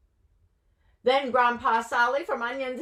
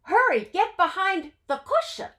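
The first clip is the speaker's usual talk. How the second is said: high, loud urgent hurry; 10 semitones higher, 6 dB louder than usual. Acted. Mendel urgently telling the matzo man to hide, high and loud. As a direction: rush him to hide.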